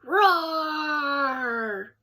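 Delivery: playful bear roar. Acted. Grandpa bear scares eagle, playful roar. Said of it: "He roared".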